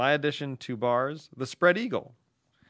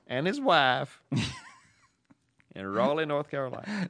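typical Southerner. Acted. Signing off a listener's letter from Raleigh, typical Southerner. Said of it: "that's your typical Southerner".

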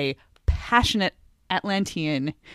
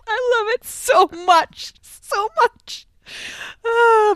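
gushing delight; 3 dB louder, much slower than usual. Real, not acted. Gushing over a bad line reading.

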